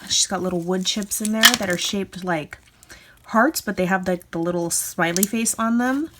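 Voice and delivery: ordinary talk, nothing marked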